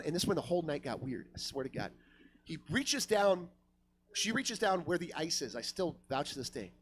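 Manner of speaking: ordinary talk, nothing marked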